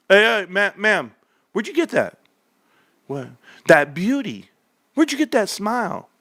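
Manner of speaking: high, eager come-on voice; flirty pickup guy. Acted. Guy hitting on a woman at the club, high eager flirt. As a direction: sweet-talk her with a grin.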